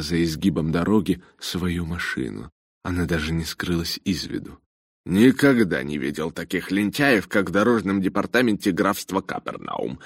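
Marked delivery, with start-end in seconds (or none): none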